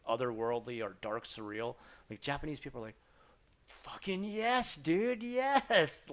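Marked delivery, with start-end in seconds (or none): 3.7-6.1 slow, high, loud mock cheering; imitated fan excitement, voice pitched way up; wildly enthusiastic. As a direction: draw out a hyped-up yes. Acting out Japanese people's enthusiasm.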